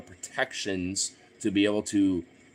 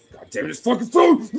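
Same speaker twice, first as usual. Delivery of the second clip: reenacted furious yell, much higher and louder; frustrated yell; 10 dB louder than usual. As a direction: blow up at the broken phone. Retelling frustration at broken phone.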